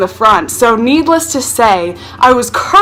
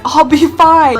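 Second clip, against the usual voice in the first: put-on breezy kid; 6 semitones higher than usual. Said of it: "And I said".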